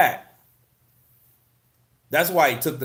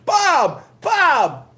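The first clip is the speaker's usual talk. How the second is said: loud high call; 9 dB louder than usual. Real, not acted. Calling out the name.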